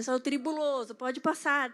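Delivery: "tribuloso" called out loud and falling; loud mock preaching. Joking about traffic, calling "tribuloso" out loud.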